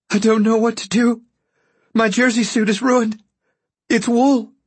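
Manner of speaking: pitched far above the narrator, helpless; distraught woman. Acted. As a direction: play her high and distraught. Voices distraught woman in story.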